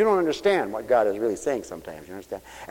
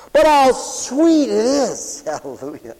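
loud, higher, slow pulpit fire; full-force preaching; 12 semitones higher, 9 dB louder, slower than usual. Acted. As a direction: proclaim it to the whole church.

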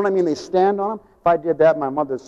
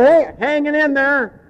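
mocking cheer; 9 semitones higher, 3 dB louder, slower than usual. Mocking the phrase.

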